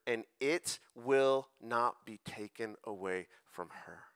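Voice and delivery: very slow, deliberate delivery with pauses; solemn scripture reading. Acted. Jesus answering worried Martha, read slowly and solemnly. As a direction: let each phrase of the verse land, unhurried.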